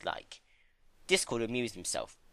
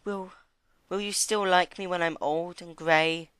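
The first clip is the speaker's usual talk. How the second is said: teasing; 9 semitones higher than usual. Acted. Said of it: "she teased".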